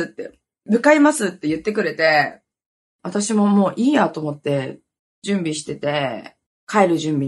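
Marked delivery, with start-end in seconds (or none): 0.7-1.3 higher-pitched firm announcement; imitated earnest rescuer. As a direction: voice a friend promising to come over. 助けに来る女性の台詞を再現. (助けに来る女性の真似、高めに宣言)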